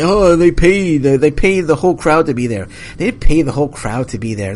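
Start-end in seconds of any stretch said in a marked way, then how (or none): 0.0-2.7 higher, louder mock voice of social media critics. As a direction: parrot the online crowd with scorn. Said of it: "People say".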